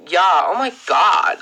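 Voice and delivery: slow, dumbed-down California accent; basic Californian girl. Shown as a demonstration. Vlogger demonstrating their best American accent, dumbed-down Californian.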